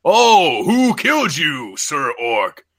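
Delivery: loud, drawn-out demonic interrogation; orc Lucifer. Acted. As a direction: boom the question grandly, then let it drop. Orc Lucifer questioning the dead orc.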